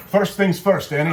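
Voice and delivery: put-on Scottish accent. Calling partner to start the recipe in a Scottish accent. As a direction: put on a loud, high-pitched Scottish lilt. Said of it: "I was in the Scottish accent".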